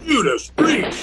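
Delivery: sudden high, loud exclamation; startled oath. Acted. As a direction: jump at the crash and blurt it out.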